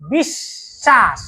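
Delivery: বিশ্বাস drawn out slowly as "bish-shash"; slow teaching model of বিশ্বাস, long sh, no b; doubled sh. Shown as a demonstration. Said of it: "একটু আওয়াজ করে উচ্চারণ করো".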